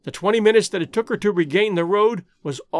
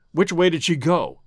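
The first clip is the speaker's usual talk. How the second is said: usual speaking voice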